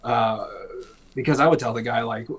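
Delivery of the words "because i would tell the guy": usual speaking voice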